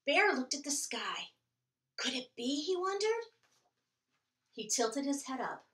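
put-on lively read-aloud for children; storytime reading. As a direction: read it like a picture book to kids. Reading picture book aloud.

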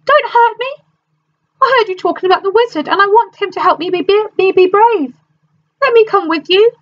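high, quick, nervous pleading; cowardly lion. A narrator's reading. Cowardly lion pleading with the travellers, high and nervous. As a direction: rush it out high and timid.

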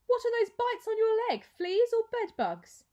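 high, loud sneering accusation; disdainful. A narrator's reading. Mother accusing Clovis of fleas, high and disdainful. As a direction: voice a snobbish matron's disgust.

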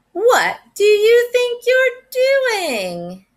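put-on old owl scolding the fox; stern old owl. A narrator's reading. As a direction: catch the fox in the act.

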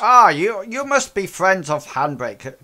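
loud, high, jovial welcome; hearty boss voice. Acted. Boss welcoming Handbrake's friends in, loud and jovial. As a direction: boom a cheery welcome.

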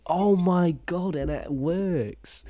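real surprised delight; amazed. Real, not acted. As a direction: let the wonder stretch the words out. Amazed by working camera costume.

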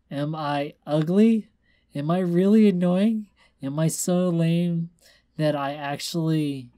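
put-on high, loud drunken self-pity; distraught pleading for reassurance; upset drunken outburst. A narrator's reading. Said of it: "Twilight shuddered".

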